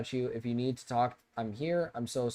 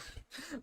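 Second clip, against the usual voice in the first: burst of laughter; 15 dB quieter than usual. Real, not acted. Breaks up laughing mid-read.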